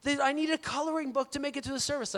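put-on high-pitched little-kid voice; playfully mimicked child's request; three-year-old daughter. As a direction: imitate a small child pleading with Dad. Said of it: "my three-year-old in particular".

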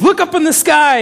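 mock excited bystander. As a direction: point at the sky in mock awe. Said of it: "one man who says".

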